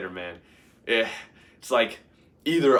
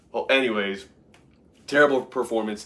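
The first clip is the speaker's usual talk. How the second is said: ordinary talk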